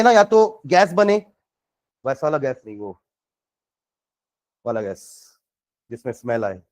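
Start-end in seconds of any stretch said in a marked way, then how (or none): 2.0-6.6 soft aside. Real, not acted. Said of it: "aside to students".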